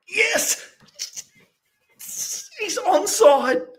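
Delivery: high, slow, shouted cry of joy; goal celebration. Real, not acted. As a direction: scream it out as the goal goes in. Goal scored, fan erupts.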